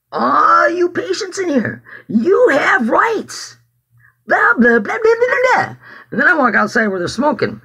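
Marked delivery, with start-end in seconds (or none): 0.1-5.8 high, drawn-out retold call to a whole room; mock rallying cry trailing into blahs; proclaiming. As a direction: call it out slowly, high and ringing. Replays announcing rights to patients.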